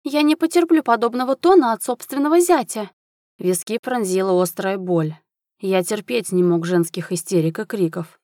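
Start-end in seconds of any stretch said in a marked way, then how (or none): 0.0-2.9 high-pitched, scolding countess; haughty, high indignation; outraged shriek. A narrator's reading. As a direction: protest shrilly at an insolent son-in-law. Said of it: "взвизгнула графиня".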